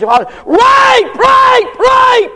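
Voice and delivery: retold hysterical scream. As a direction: shriek it out like a false accuser. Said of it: "She goes screaming out the door".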